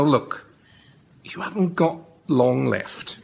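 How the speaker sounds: normal talking voice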